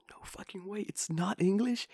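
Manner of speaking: high, hushed disbelief; disbelieving teacher impression. Teacher finds out it is not English, hushed high disbelief. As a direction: imitate stunned realisation, small high voice.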